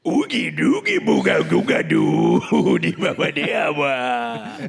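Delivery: slow alien gibberish; slow, gurgly alien babble; Jabba the Hutt. Retold or imitated. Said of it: "my impression of Jabba the Hutt".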